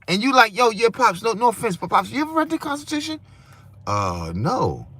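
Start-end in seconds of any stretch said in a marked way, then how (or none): 0.5-3.2 higher, faster, prodding son to his pops; lightly voiced eager son, quick and pushy; son questioning father. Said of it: "imagine talking to your father". 3.9-4.9 sheepish father. Pretend. Father answering son sheepishly.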